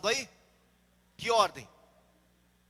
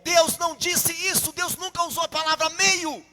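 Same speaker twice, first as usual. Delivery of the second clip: loud, raised preaching protest; emphatic objection; 6 semitones higher, 9 dB louder than usual. Real, not acted. Preacher objecting to Eve's misquote of God, loud insistence. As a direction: cut in forcefully, voice up.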